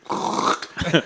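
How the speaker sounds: rasped throat grinding sound; voiceless, soft crunching scrape; imitated scraping noise. Son imitating couch grinding into wall, scraping. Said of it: "it's like".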